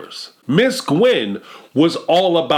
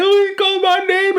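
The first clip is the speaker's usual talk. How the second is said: very high, hammed-up gushing; exaggerated high delighted parent; mock teary excitement. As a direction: gush like a proud parent near tears. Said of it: "and they crying".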